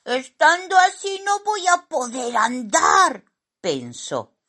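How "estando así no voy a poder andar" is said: high, squeaky complaint; cartoonish high-pitched dismay; fretting little character. A narrator's reading. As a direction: fret aloud in a tiny voice. Simón stuck on his back.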